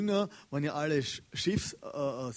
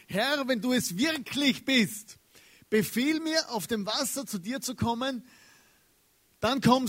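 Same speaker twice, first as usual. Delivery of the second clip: higher, loud, slow call across the storm; 7 semitones higher, 3 dB louder than usual. Retold or imitated. Peter calling from the boat to Jesus, slow and loud. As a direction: call it across the waves to the Lord. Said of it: "Da rief Petrus zu".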